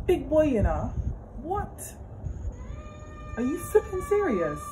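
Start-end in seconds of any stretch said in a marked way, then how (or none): 1.4-1.9 soft, high questioning "what?"; a quiet, high yelp of disbelief; incredulous. Real, not acted. Vlogger baffled by a crying big boy, incredulous. 3.4-4.6 high-pitched, exasperated disbelief; incredulous. Real, not acted. Vlogger reacting to a big boy crying, incredulous.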